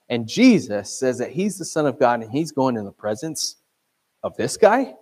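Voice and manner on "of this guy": raised, louder disbelieving question; incredulous, rising at the end; mock incredulity. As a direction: say it as a scandalised onlooker. Voicing the crowd's disbelief at Zacchaeus.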